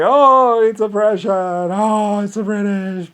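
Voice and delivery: high, loud mock wailing. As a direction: parody crowd crying oppression. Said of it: "he has his people like".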